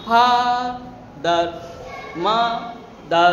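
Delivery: very slow, drawn out, split into two beats; syllable by syllable. Shown as a demonstration. Teacher showing students two-syllable words, syllable by syllable. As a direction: break "father, mother" into ফা, দার, মা, দার with pauses. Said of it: "মা যখন উচ্চারণ করছে একবার".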